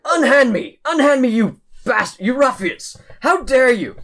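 high, loud outraged bellow; indignant shouting. Acted. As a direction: rage at the guards like a wronged noble. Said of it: "cursing and cussing at a couple of palace guards".

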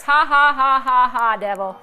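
loud put-on laugh, falling away; demonstrated triumphant laughter; laughing at the devil. Shown as a demonstration. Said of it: "when I start laughing".

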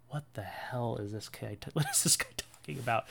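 hushed imitation of confused listeners; soft, puzzled aside; baffled listeners. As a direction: murmur it softly in mock bewilderment. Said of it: "you're all sitting there going".

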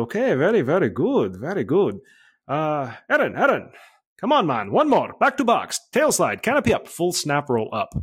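high, singsong imitation of a coach on the radio; Nick. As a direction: mimic radioed praise and orders, pitch swooping up and down. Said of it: "Nick comes on the radio".

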